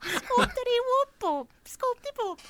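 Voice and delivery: high squeaky nonsense chant; silly sing-song voice. Retold or imitated. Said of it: "what did you say?".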